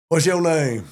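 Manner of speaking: a mock drill sergeant demanding a name. Drill sergeant quote in story.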